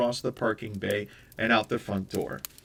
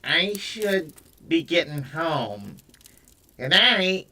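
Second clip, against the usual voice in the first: higher, slow nasal New York twang; 7 semitones higher, 4 dB louder, slower than usual. Acted. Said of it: "in her nasal New York twang".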